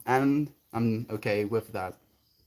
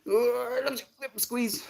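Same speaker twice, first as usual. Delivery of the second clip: high squeaky voice; 12 semitones higher than usual. Acted. Put-on squeaky voice.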